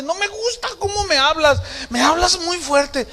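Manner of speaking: high-pitched mock whine of protest; complaining daughter. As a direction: imitate an upset daughter scolding dad. Daughter acted complaining to father.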